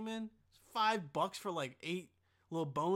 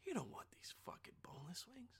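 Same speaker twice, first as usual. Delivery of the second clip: soft whisper of a warning inner voice; 3 semitones higher, 11 dB quieter than usual. Pretend. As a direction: hush it like a conscience nagging. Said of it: "Something on the back of my mind was like".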